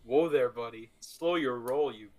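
ordinary talk, nothing marked

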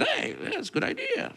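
chirpy agreement. Retold or imitated. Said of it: "And so they said".

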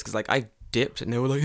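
usual speaking voice

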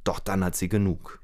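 ordinary delivery

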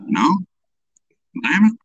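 ordinary talk, nothing marked

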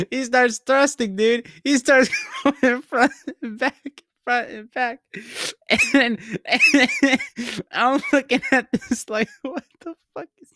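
high voice breaking into real laughs; crying with laughter. Real, not acted. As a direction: fight to get the story out through laughter. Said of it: "I'm crying from it".

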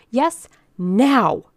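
loud, high demand; insistent cry. A narrator's reading. Old lady cuts off the organizer, crying insistently. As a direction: cry it out, punching the last word. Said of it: "cried Missus Bellows".

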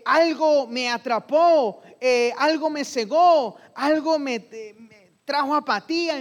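súplica alta y fuerte, con fervor; oración ferviente. Acted. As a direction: clamar a Dios desde la angustia. Oración modelo en voz de fiel. (el fiel ruega al Señor, ferviente, agudo y fuerte)

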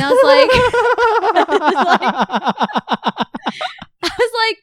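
breaking into high laughter; laughing. Real, not acted. Retelling the ghost plea to her friend, laughing hard. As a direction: laughs through the words, pitch way up.

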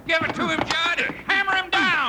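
high, excited yelling from the sidelines; egging on a fight. Acted. Henchman cheering his partner on in the brawl, excited high yells.

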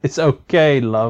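light cockney "love"; mock London accent. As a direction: join in as a London geezer. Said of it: "You gotta say it in an accent".

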